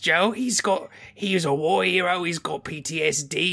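put-on squeaky Cockney kid; British accent. Hacker kid voice again.

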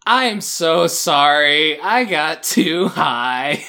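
slow, drawn-out confession; exaggeratedly slow, emphatic apology; retold stoned apology. Retold confession to coworker while high.

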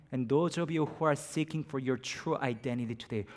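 ordinary talk

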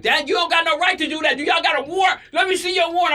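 high, indignant mock protest; imitating arguing nephew. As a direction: play a relative challenging the police. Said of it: "I can hear my nephew arguing".

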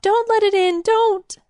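high, loud, frantic pleading; panicked sobbing cry. A narrator's reading. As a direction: beg in sheer terror. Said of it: "she sobbed".

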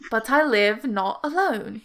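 slow, measured riddle with lingering key words; riddle recital. Acted. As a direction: pose the riddle deliberately, word by word. Repeats riddle in character.

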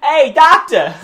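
high loud call of Doctor; Marty calling Doctor. Retold or imitated. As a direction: shout across the street like Marty. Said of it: "Marty always refers to him as Doctor".

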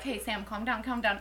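hushed, low reassurance; retold calming down. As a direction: talk a friend down quietly. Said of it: "people are like".

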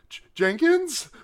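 high, loud, drawn-out questioning call; mock distraught foreman calling out slowly. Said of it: "he seems genuinely distraught".

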